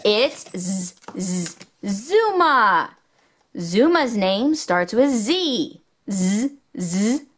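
usual speaking voice

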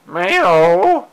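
high playful cat meow; mock meow. Meowing at her cat.

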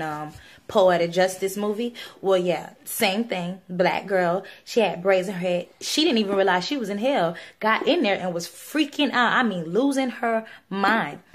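ordinary talk

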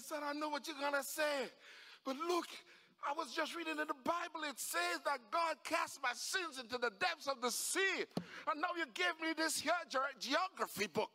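high, insistent explaining; worked-up, emphatic justification; excited father. Acted. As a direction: plead the case with mounting wonder. Father excitedly defends his shouting.

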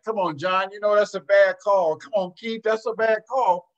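loud, high-pitched heckle at the refs; sideline yell. Retold or imitated. Coach shouting at referees from the sideline, loud and high. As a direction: call out the bad call across the gym.

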